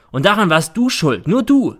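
loud, rising in pitch; accusing. A narrator's reading. Son blaming his mother, heated accusation. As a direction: drive the blame home.

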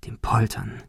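hushed, ominous whisper; whispered repetition. A narrator's reading. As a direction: drop to a whisper as it dawns. Repeated as ominous realisation. (unheilvoll geflüstert)

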